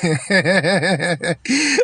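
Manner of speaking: long rippling chuckle of ridicule; mocking laugh. Real, not acted. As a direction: laugh heartily at his pretensions. Laughing at the mocked accent.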